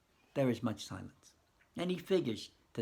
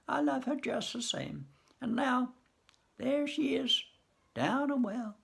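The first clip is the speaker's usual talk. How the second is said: put-on grieving old husband; 12 semitones higher than usual. Harley telling Nicely Nicely he loves her, high and grieving. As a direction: cry out his love for her. Harley mourns his ex-wife.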